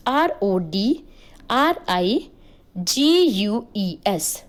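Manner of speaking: very slow spelling: आर ओ डी, आर आई, जी यू ई एस; spelled out letter by letter. Shown as a demonstration. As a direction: spell Rodriguez out, pausing between letters. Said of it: "and Rodriguez".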